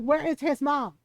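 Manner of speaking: high, loud indignant demand; mock-furious mother demanding answers; imitating mad mom. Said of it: "She was so mad".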